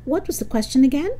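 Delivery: high, quick, puzzled question; Arnold, caught off guard. Acted. Arnold asks Mister Simmons, high and bewildered. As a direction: blurt it out, having lost the thread.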